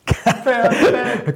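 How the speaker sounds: hohes, kurzes Auflachen; Lachen. Real, not acted. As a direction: lach kurz und hell auf. Lacht über Namenswitz. (helles Auflachen)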